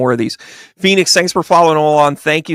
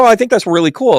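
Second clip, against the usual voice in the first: high, louder fake delight; mock enthusiasm; 8 semitones higher, 5 dB louder than usual. Psychic bluffing to a client, with forced high cheer. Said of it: "And I go".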